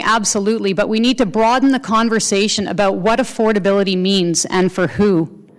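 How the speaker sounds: usual speaking voice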